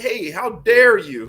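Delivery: high, loud, slow hammed-up outrage; mock offended. Mocking a friend's offended reply, hammed-up indignation. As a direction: clutch your pearls in fake outrage. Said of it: "you were super offended".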